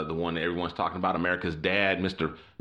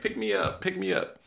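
imitating a spoiled whiner begging; light mock whine, partly high-pitched; whining Jack; 14 semitones higher than usual. Jack begging to be picked up, mock whining. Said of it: "he was still whining".

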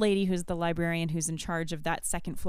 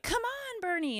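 put-on very high bossy kid voice; imitating older sister; 13 semitones higher, 5 dB quieter than usual. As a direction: boss your little sister.

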